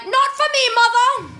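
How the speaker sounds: put-on high, loud, prim British accent; a hammed-up British accent with a haughty flourish; British baby refusal. Baby daughter rejecting her mother's voice, in a mock British accent. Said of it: "She heard my voice from the womb and was like".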